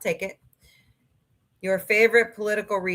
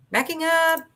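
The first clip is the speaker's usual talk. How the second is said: SpongeBob voice; 9 semitones higher than usual. Retold or imitated. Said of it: "as SpongeBob would say".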